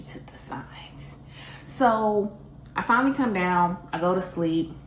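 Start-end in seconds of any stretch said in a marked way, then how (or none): 0.0-1.2 conspiratorial whisper. Real, not acted. Confessional aside to the audience.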